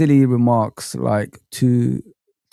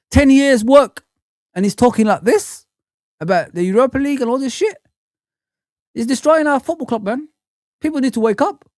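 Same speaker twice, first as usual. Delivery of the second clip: heated rant; 8 semitones higher than usual. Real, not acted. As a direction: vent like a furious fan on stream. Ranting about the Liverpool coach.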